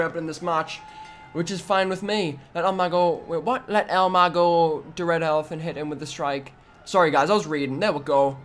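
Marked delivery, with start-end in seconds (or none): none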